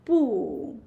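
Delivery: 不 firm and loud, pitch dropping; a strong downward 不; falling tone. Shown as a demonstration. Teacher repeats 不 like this, falling tone. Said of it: "falling tone".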